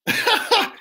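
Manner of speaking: quick high giggle; real laugh. Real, not acted. Poet quoting Brother Jamie, real laugh. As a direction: crack up briefly mid-sentence. Cracks up mid-line.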